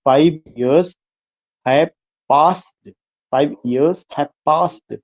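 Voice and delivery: "years" and "passed" stressed, "Five" and "have" lighter; slow scanning beat, landing on "years" and "passed"; alternating syllable stress. Shown as a demonstration. Teacher scanning verse for students, slow alternating stress. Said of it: "stressed syllable है".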